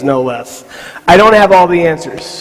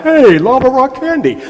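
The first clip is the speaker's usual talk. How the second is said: lightly voiced goofy kid, higher pitch; hint of an over-eager guy; imitating dim guys; 6 semitones higher than usual. Imitating guys mistaking pebbles for candy.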